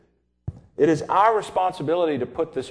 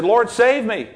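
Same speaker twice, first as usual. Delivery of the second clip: drowning man calling; 8 semitones higher, 5 dB louder than usual. Retold or imitated. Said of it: "he said".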